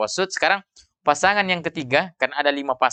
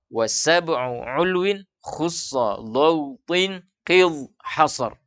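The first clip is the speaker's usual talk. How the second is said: measured, drawn-out Arabic recitation; slow verse recitation; slower than usual. Shown as a demonstration. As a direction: recite the verse slowly, stretching each word. Repeating the Arabic verse for students.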